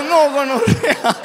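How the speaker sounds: grito agudo, lento, riendo; grito exasperado. Retold or imitated. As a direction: estalla de exasperación. Exasperación entre risas. (grito exasperado riendo)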